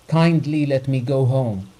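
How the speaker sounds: loud demand, breathing hard; the doctor shouting to leave, out of breath; shouted. A narrator's reading. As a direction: shout to be let go. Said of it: "shouted the doctor, breathing hard".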